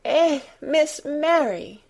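high, slow, drawn-out dismay; half crying. A narrator's reading. Martha to Miss Mary, half-crying dismay. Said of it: "half crying".

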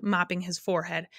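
ordinary delivery, nothing marked

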